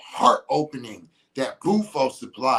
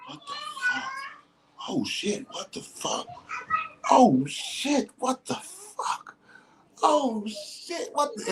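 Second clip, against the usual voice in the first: higher pitched looping what the fuck, oh shit; mock freak-out, lines jumping higher; bewildered panic; 14 semitones higher, slower than usual. Imitating someone tripping on mushrooms, a mild mock freak-out. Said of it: "Whereas the mushrooms is like".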